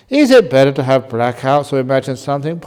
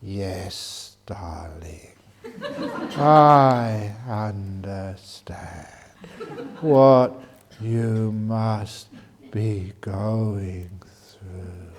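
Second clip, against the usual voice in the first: soft, slow, whispered soothing; calm and quiet husband; 4 semitones lower, much slower than usual. Retold or imitated. Husband calming his nagging wife, soft and slow. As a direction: gently placate the nagging wife. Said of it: "He just goes very calm and quiet".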